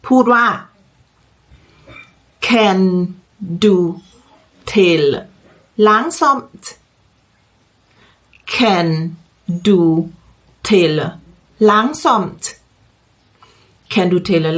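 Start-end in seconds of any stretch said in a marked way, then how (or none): 2.5-6.6 slow model phrase with a pause after each word. Shown as a demonstration. Teacher models question word by word. 8.5-12.4 slow model question, pausing after each word. Shown as a demonstration. Word by word, then connected.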